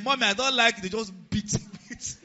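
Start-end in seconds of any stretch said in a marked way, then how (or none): 0.0-0.8 lightly voiced child, higher and louder; child talking back. Small child talking back to mommy, lightly acted protest.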